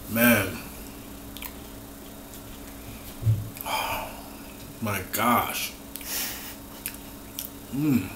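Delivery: talking while eating; chewing. Real, not acted. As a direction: mouth full of cookie. Seen 0: Bites cookie at -4, mouth closed chewing, then holds bitten cookie.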